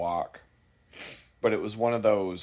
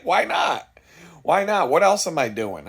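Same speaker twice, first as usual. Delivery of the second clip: excited self-quote; 7 semitones higher, 6 dB louder than usual. Retold or imitated. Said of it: "So I was like".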